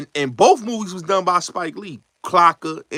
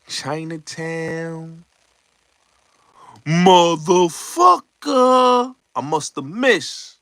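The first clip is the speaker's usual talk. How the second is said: slow drawn-out quote; 2 semitones higher, much slower than usual. Retold or imitated. Retelling [name]'s line.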